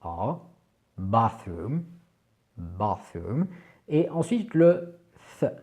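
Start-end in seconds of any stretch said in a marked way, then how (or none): 1.0-3.5 bathroom with a deep back A; bathroom, guttural A. Shown as a demonstration. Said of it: "un peu plus guttural".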